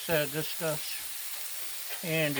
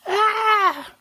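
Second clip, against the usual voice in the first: very loud, high held shriek; sudden scream; 11 dB louder than usual. Pretend. Cook mistaking you for someone else, sudden high scream. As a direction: let out a sudden piercing "aah". Sudden cry, then apologizes.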